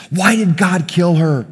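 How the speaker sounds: grieving young man yelling at the pastor, mildly acted; retold yell. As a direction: hurl the question at the pastor. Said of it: "Starts just yelling at me".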